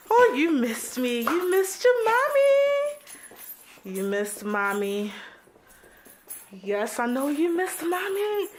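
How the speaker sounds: high, very slow cooing. Real, not acted. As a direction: stretch out your mommy sweetly. Cooing to pet.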